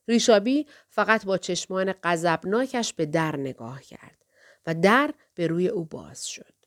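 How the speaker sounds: usual speaking voice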